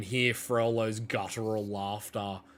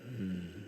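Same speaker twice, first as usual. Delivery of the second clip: low hum from the corner; 2 semitones lower, 9 dB quieter than usual. Acted. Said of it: "you just hear this".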